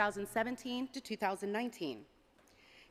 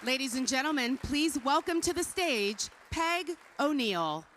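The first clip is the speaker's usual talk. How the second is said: announcer voice; 3 semitones higher than usual. Acted. Stage announcer introducing award recipient.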